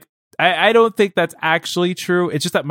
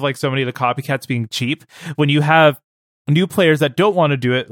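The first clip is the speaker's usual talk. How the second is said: usual speaking voice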